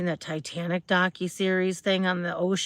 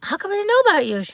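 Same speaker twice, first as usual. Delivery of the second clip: high, quick, thrilled question; excited retold question; 12 semitones higher, 5 dB louder, faster than usual. Host retelling meeting the hair stylist, excited. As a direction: gush at the discovery. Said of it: "I was like".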